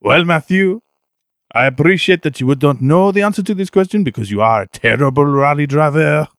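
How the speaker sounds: put-on over-the-top French-accented rally star greeting his host; Sebastian Loeb French accent. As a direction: play a boastful Frenchman with a thick French accent. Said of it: "I am Sebastian Loeb".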